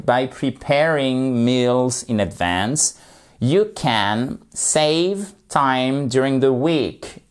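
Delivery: whole sentence read slowly and evenly; slow model sentence. Shown as a demonstration. Teacher reads the example sentence slowly to the class. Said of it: "slow model sentence".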